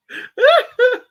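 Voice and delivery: shrieking high laugh; loud high laugh. Real, not acted. Laughing at the child off-camera, high shriek. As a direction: burst out in a squeal of laughter.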